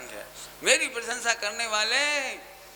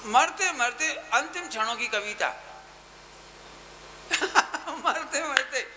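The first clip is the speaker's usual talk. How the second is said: laughing in wonder; 3 semitones higher than usual. Real, not acted. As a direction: laugh at how wondrous it is. Marvels at the dying poem.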